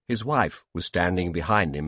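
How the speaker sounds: ordinary delivery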